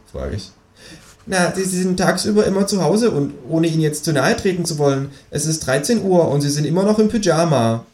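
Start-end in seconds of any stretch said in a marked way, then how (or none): 1.3-7.8 high, bouncy know-it-all kangaroo; cheeky kangaroo voice. Acted. Kangaroo lecturing the narrator about precious time, high and cheeky. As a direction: play the smug kangaroo, pitched well up.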